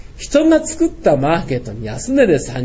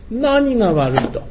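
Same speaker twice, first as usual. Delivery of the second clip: 開き直り; 7 semitones higher, slower than usual. Retold or imitated. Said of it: "いつものように言ってやったのね".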